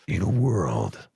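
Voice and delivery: deep, slow trailer announcer; movie trailer voice. Shown as a demonstration. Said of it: "You're the one that can do movie trailer guy voice".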